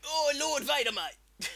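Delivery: put-on high, loud forced Australian accent. Australian officer greeting Vader, forced Australian accent. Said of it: "stretch your Australian accent".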